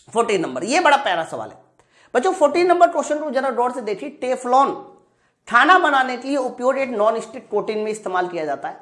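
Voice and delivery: ordinary talk, nothing marked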